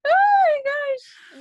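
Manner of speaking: high, loud, drawn-out laughing squeal; real helpless laughter. Real, not acted. Listener reacting to co-host's impression, helpless laughter. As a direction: gasp it out slowly while cracking up.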